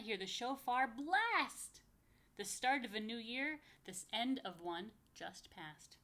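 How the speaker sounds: lively picture-book reading; reading to children. Acted. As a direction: bounce the rhymes for little listeners. Reads rhyming picture book aloud.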